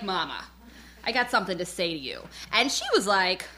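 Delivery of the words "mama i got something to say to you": a hint of a grave confession; mock stern daughter. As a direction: lower and softer, hushed warning to mama. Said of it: "and I'm like".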